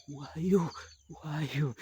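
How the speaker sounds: high monster demand; ogre voice. Acted. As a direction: growl the question high.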